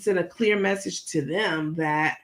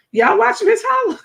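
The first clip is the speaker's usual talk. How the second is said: normal talking voice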